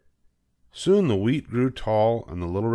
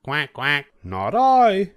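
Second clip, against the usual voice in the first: high duck voice, louder refusal; a duck quacking then refusing; 7 semitones higher, 6 dB louder, slower than usual. A narrator's reading. Duck refusing to help the hen in a high voice. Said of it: "said the duck".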